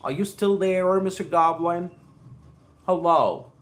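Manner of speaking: ordinary talk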